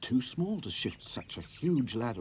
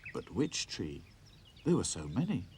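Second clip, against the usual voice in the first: plain reading voice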